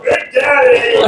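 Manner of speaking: slow, high, drawn-out yell; yelled Big Daddy. Retold or imitated. Girl yells at the dad in the dorm hallway. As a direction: call it out long and high. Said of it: "just yelled".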